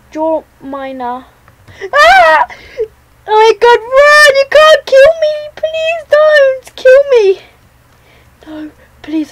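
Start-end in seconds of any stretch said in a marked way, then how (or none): 1.9-5.3 high, loud panicked shrieks; terrified screaming. Real, not acted. Seeker finds him, panics. 5.6-7.4 high, loud, slow desperate begging; screamed pleading. Real, not acted. Begging the seeker while fleeing.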